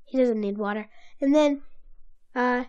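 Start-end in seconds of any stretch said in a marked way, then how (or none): none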